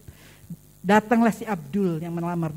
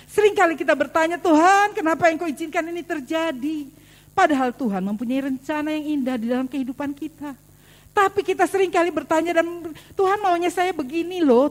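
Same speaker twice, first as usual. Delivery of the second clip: raised, higher, louder preaching; preaching at full force; 7 semitones higher, 6 dB louder than usual. Acted. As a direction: preach it out to the congregation, voice lifted. (berkhotbah dengan suara lantang dan tinggi)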